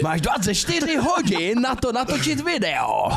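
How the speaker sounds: put-on squeaky slow old-man threat. Mimicking the caller demanding a video, high and slow. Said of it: "zněl jak takový děda".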